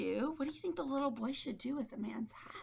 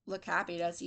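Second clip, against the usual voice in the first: usual speaking voice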